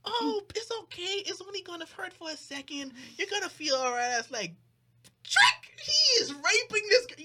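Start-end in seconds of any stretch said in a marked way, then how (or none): 0.0-4.2 put-on coaxing girl. Friend voiced, high coaxing. 5.2-7.2 high loud outraged cry; shocked yell. Retold or imitated. Telling cohosts the twist in a high loud outburst.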